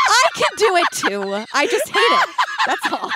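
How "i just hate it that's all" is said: ordinary delivery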